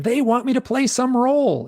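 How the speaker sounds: high-pitched mock scream; retold scream. Screaming the answer to his hypnotherapist, high imitated outburst. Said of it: "And I scream".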